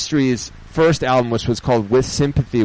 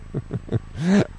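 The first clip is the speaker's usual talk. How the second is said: laugh; 5 semitones higher, 5 dB quieter than usual. Real, not acted. Laughs at listener's mixup.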